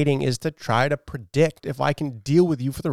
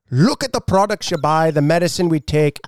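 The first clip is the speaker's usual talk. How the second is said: higher-pitched, hammed-up Scottish accent; 4 semitones higher, 4 dB louder than usual. Shown as a demonstration. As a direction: perform it as a bad Scot. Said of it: "can you read this in a Scottish accent".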